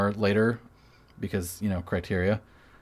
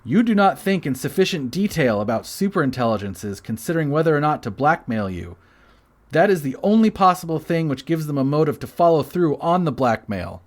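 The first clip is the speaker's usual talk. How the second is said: raised pitch, emphatic declaiming; all caps reading; 5 semitones higher, 3 dB louder than usual. Acted. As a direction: punch out the words as if all capitals. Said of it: "I like reading in all caps".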